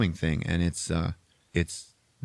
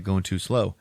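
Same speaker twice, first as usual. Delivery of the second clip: normal talking voice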